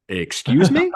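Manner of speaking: high, loud offended disbelief; indignant excuse me. Retold or imitated. Players answering the taunting fans, high and loud. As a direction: act out the shock of the insult. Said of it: "And we're like".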